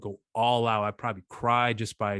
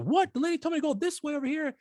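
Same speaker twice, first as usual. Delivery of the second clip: dad's baffled protest in a voice pitched way up; mock confused dad. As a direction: squeak it out like a lost, flustered father.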